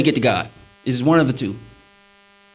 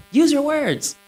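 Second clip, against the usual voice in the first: higher-pitched mock wife voice; nagging wife; 9 semitones higher, slower than usual. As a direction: imitate a wife prodding for an answer. Acts wife urging him to talk.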